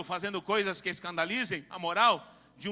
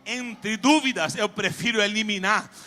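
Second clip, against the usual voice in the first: loud, emphatic, stretched-out declarations; full-force preaching; 3 semitones higher, 10 dB louder, slower than usual. Real, not acted. Preacher urging church to take a decision, loud and forceful. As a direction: drive each declaration home, building louder.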